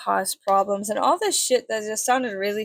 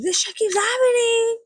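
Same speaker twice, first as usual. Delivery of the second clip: laughing cry; 12 semitones higher than usual. Real, not acted. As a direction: cry it out half-laughing, half-wailing. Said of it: "It's just so funny".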